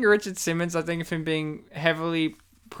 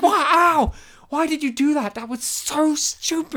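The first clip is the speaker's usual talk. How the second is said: hammed-up aggrieved whine, pitched high; mock outrage; 9 semitones higher than usual. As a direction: imitate a man yelping in protest, slowing at the end. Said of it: "felt like he wasn't acting".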